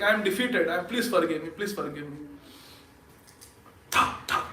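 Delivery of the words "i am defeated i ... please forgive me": sinking from soft to barely audible; fading plea. Acted. Devotee begging the Lord for forgiveness, fading to a murmur. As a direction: let the apology die away into a murmur.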